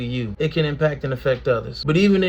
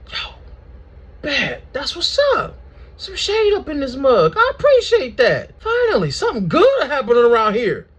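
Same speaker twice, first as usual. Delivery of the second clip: high, gleeful, almost laughing; relieved delight; 10 semitones higher, 4 dB louder than usual. Acted. Jonah thanking God for the shade, high and delighted. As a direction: savor the shade like a treat.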